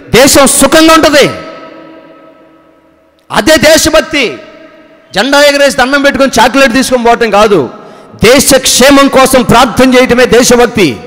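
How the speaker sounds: loud, high sermon delivery, hammering each phrase; fiery preaching. Acted. Pastor denouncing false patriotism to the congregation in loud fiery preaching. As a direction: preach it with full conviction to the congregation.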